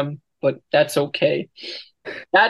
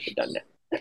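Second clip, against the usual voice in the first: whisper; 12 dB quieter than usual. Real, not acted. Mock ending of the conversation.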